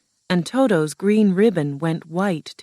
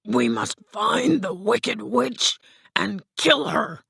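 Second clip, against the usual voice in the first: higher, slow husky lion voice; Cowardly Lion; 5 semitones higher than usual. Acted. Lion urges friends to hunt the witch, slow creature voice. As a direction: urge it slowly in a lion character voice.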